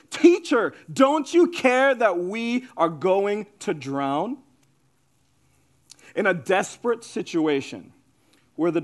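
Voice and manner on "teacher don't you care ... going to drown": ordinary delivery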